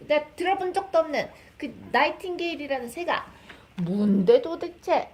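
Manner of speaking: fast, loud indignant king. Acted. Mother translating for her baby, a fast, loud demanding king. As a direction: rattle it off like a baffled king. Mother retells king's outburst in Korean.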